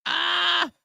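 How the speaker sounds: high yelp into a laugh; delighted squeal of laughter; shrieking laugh. Real, not acted. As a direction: howl with laughter. Laughing at the tweet quote.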